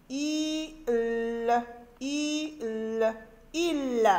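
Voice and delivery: I and L held long, then joined as Il; sounds blended into syllable. Shown as a demonstration. Teacher forming a syllable for children, sounds drawn out and blended. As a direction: stretch I, then L, then glide them into Il. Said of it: "forme et lit des syllabes".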